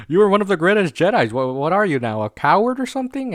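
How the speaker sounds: high, loud retold disbelief; incredulous challenge. Jedi confronting Obi-Wan over his retreat, loud mock incredulity. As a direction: challenge him in disbelief, pitch leaping up. Acted challenge to a fallen hero.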